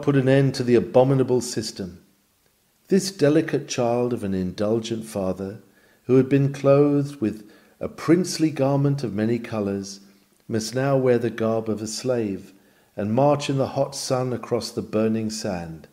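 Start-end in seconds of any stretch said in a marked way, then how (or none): none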